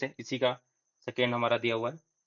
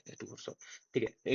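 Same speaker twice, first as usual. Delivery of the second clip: muttered aside; 5 semitones lower, 13 dB quieter than usual. Real, not acted. Self-correction aside while writing.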